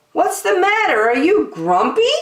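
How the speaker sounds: high, loud caring question; concerned monkey. Acted. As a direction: Jim checking on his friend. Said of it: "asked Jim".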